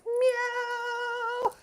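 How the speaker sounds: very high cat meow. Pretend. Repeating the joke for viewers, high playful meow. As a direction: squeak out a cat's meow. Meowing after quoting Lindy.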